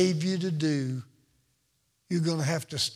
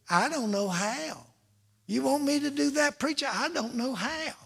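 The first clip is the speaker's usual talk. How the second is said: whiny excuse voice; 7 semitones higher than usual. Acted. Mimicking congregant's excuse.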